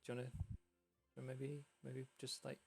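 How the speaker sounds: soft, halting, sly invitation; hushed little voice tempting someone in; coaxing voice from pool. Acted. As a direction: suggest it quietly and tentatively. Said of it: "The voice just comes over again".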